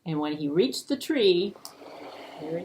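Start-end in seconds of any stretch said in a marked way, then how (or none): none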